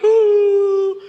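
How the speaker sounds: a high, loud, choked-up "Ooh"; overcome, voice catching on a cry. Retold or imitated. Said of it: "I got all verklempt".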